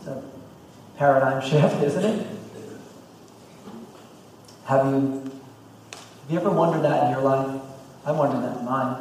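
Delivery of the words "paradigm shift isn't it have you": ordinary talk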